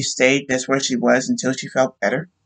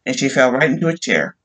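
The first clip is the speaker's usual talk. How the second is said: ordinary delivery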